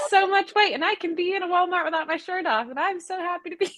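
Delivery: high-pitched, gushing Eddie impression; Shirtless Eddie. Co-host mimicking a TikToker's Walmart announcement, high-pitched imitation. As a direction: imitate Eddie, pitched well above your own voice. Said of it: "he's like".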